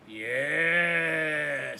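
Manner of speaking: loud booming far-off voice of the dark. Acted. The dark answers the boy, booming. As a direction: boom it out like the dark itself. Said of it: "said the dark".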